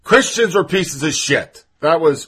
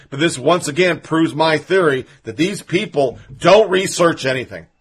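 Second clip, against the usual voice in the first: normal talking voice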